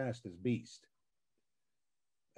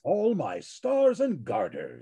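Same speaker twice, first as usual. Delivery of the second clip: slow, higher, grand Beast delivery with a laugh; Beast catchphrase; 5 semitones higher, slower than usual. Pretend. Reprising his Beast catchphrase for the panel, slow and grand. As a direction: savour each word of the catchphrase, slow and grand. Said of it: "Can you say it again?".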